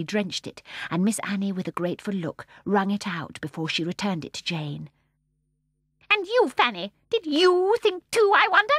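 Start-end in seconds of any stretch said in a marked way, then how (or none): 6.1-8.7 put-on high, loud, menacing old woman; high little voice. A narrator's reading. Mrs Corrie rounding on Fanny, high menacing voice. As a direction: needle her. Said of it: "The high little voice".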